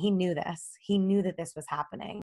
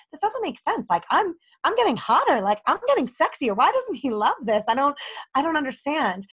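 high-pitched, baffled insistence; bewildered protest; 6 semitones higher than usual. Retold or imitated. Girlfriend retelling gym fights with boyfriend, high and bewildered. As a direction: protest in a higher voice, genuinely puzzled.

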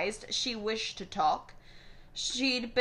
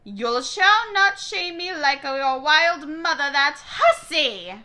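put-on loud, high scolding old woman; ranting grandmother; 7 semitones higher, 9 dB louder than usual. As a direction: rant at her, shrill with contempt. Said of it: "her grandmother's ranting".

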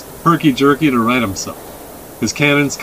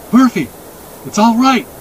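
put-on high, louder boyish call; boy calling horse; 10 semitones higher, 3 dB louder than usual. A narrator's reading. Boy tells his horse to go, calling.

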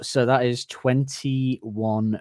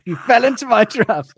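loud gloating taunt; 12 semitones higher, 8 dB louder than usual. Real, not acted. Quizmaster gloats at wrong answer.

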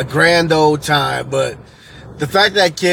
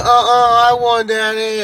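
put-on higher-pitched kid insisting to Daddy; protesting child; 8 semitones higher than usual. Kid arguing with dad over a win, mock protest.

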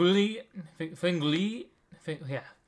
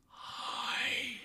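soft, breathy ghostly whisper; 6 semitones higher, 7 dB quieter than usual. Retold or imitated. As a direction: whisper it like an unseen presence. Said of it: "And I heard".

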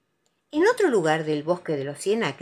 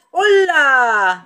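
big, loud, drawn-out hello, higher; beaming greeting; 7 semitones higher, 11 dB louder than usual. A narrator's reading. Tiger bouncing in to see Pooh, cheerful. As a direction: sing out a smiling hello. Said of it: "dijo Tiger con una sonrisa".

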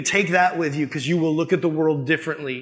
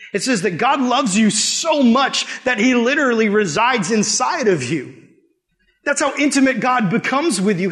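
preaching; 7 semitones higher, 4 dB louder than usual. Acted. Rising sermon intensity.

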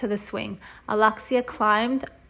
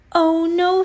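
high, loud hammed-up cry; dramatic alarm; 8 semitones higher, 6 dB louder than usual. Villain spotting her arch enemy, high and loud. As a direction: ham up the villain's dismay. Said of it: "shouted Captain Plaque".

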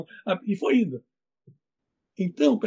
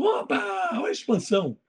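excited; 11 semitones higher than usual. Real, not acted. Exclaiming over the book's name.

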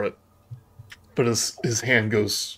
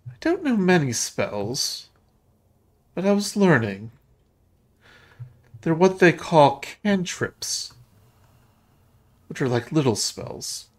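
put-on earnest ghost girl; 7 semitones higher than usual. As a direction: explain it carefully as a little girl. Voices Rose explaining her spells.

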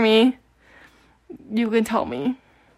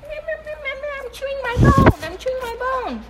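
very high, drawn-out baby-voiced dog; talking dog; 13 semitones higher, 3 dB quieter, slower than usual. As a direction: voice your dog in squeaky baby talk, trailing softer. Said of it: "Burger has something to say".

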